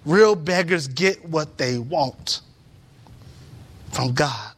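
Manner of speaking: normal talking voice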